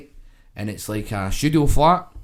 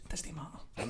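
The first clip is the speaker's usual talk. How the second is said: whisper; 20 dB quieter than usual. Retold or imitated. Hiding from Polish visitors.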